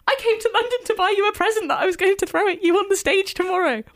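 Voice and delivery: high, fast blurted rush of words; nervous babbling. Retold or imitated. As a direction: gush it all out in one panicked breath. Nervous fan blurting to her idol.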